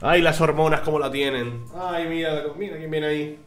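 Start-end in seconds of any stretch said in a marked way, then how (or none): 1.8-3.4 high, soft cooing at the dog; pet talk. Real, not acted. Streamer cooing softly at his dog who just came in. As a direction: coo gently to the dog.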